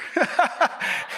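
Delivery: a high breathy burst of laughter. Real, not acted. Preacher laughs at Peter's eager flattery, high breathy laugh.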